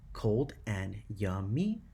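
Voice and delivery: slow, each syllable set apart; counting syllables. Shown as a demonstration. Teacher counting syllables in the second line, slowly beat by beat. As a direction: say "cold and yummy" slowly, beat by beat. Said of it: "four syllables in your second line".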